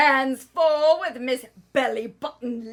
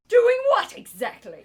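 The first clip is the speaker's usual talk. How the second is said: suspicious; 10 semitones higher, 6 dB louder than usual. Acted. Suspicious wife questioning.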